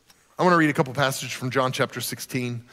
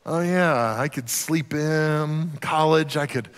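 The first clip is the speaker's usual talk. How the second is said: slow, dreamy sighs with long pauses; wistful reminiscing; much slower than usual. Acted. Preacher voices an adult recalling college, mock wistful reverie.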